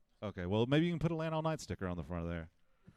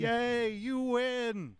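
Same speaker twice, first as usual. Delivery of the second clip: excited cheer; 13 semitones higher, 4 dB louder, much slower than usual. Real, not acted. Cheering a staff raffle winner.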